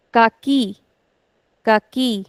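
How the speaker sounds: kaki with KI stretched; stress on last syllable. Shown as a demonstration. Teacher models kaki for students, stressing the last syllable. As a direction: lean on ki at the end of kaki. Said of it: "accent is on the last syllable".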